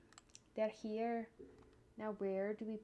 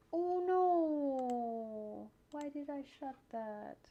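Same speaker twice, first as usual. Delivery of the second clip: slow, drawn-out dismayed cry, higher and louder; 8 semitones higher, 6 dB louder, slower than usual. Real, not acted. Editor catches a mistake, slow dismayed cry. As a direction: stretch the oh no out in real dismay. Dismayed outburst while editing.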